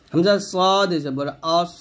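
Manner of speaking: slow, with "সদ" thick and "আস" full-mouthed; emphatic Arabic sad in "সদ" and "আস", drawn out; heavy sad. Shown as a demonstration. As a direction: stretch a heavy "সদ", closing "আস" on a thick s. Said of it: "সদ মোটা করে".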